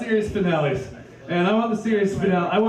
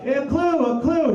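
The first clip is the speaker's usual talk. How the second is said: put-on higher, eager kid calling out; kid shouting; 7 semitones higher than usual. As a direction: shout like an excited little kid. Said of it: "the little kid would shout".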